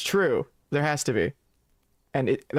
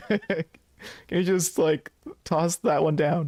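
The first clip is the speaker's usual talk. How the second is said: higher, drawn-out little plea; mock pitiful; 4 semitones higher, slower than usual. Player begs for the cigarette, mock pitiful. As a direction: beg pitifully, in play, dragging out the last words. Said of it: "the pitiful one".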